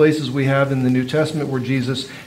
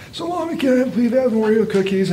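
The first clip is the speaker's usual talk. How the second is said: put-on high, raspy little boy pleading; raspy child; 6 semitones higher, 3 dB quieter than usual. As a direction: rasp it out like a small boy begging. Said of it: "He had a raspy voice as a child".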